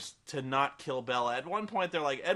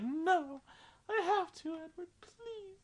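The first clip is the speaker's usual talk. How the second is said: much higher, hammed-up crying; mock sobbing plea; 4 dB quieter, slower than usual. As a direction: whimper the plea through fake tears. Bella begs Edward tearfully.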